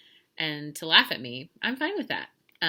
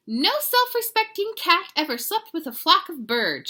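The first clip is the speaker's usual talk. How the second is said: high, loud telling-off; indignant mama lecture, acted out; scolding mother; 11 semitones higher, 8 dB louder than usual. A narrator's reading. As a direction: scold the kitten in a raised, high voice. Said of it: "she scolded".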